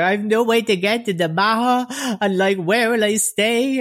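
tearful begging woman. Retold or imitated. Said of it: "kept crying about her child".

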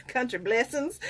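soft laugh through country blessings; amused at her own country accent. Real, not acted. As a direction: giggle out the words. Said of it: "the country accent really came out there".

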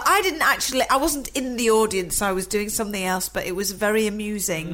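ordinary delivery

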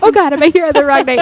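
sudden loud panicked outburst. Retold or imitated. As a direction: blurt it out in a rush of panic. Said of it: "And I panicked for a moment".

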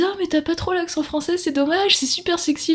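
high-pitched mock admirer; admiring fans imitated. Woman imitates people praising her French accent, high mock admiration. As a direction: put on a high, cooing fan voice. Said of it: "les gens me disent".

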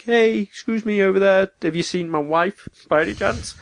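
high, polite asking voice; mock polite question. Ethan asking a stranger about his wife, high and polite. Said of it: "I wonder why he didn't be like".

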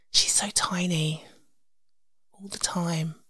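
slow, tender whisper. Shown as a demonstration. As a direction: breathe it softly like a secret.

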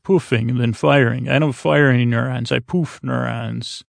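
ordinary talk

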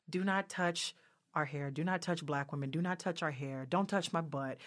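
low, quiet, evenly repeated warnings; weary recitation of rules. Acted. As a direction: list the rules like an old refrain.

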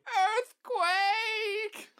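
very high shrieked warning; panicked old man cry. Retold or imitated. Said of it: "you just hear Grandpa say".

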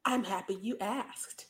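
low, soft, slow polished voice; slow and hushed, like a formal host; interview voice. Pretend. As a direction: put on a smooth serious-journalist voice. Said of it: "what if that was my real voice?".

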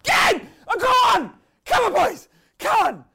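furious high shout. Real, not acted. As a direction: yell like an angry fan. Frustrated football fan outburst.

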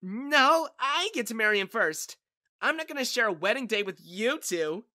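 high, loud protest. A narrator's reading. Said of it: "protested Lacey".

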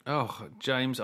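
normal talking voice

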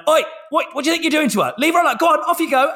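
high, sharp scolding call; retold telling-off. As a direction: call out to shoo off the bullies. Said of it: "I've started telling off teenagers".

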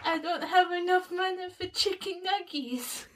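sad meme character voice. Retold or imitated. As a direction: deliver the meme line. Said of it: "that's the meme".

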